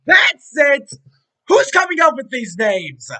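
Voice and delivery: loud furious rant; angry shouting. Acted. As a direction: blow up in frustration. Mock outburst about episode names.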